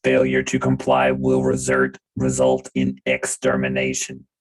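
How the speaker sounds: low, slow, loud droid monotone; robotic voice. Acted. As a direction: boom it out like a battle droid. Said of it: "uh, robotic voice".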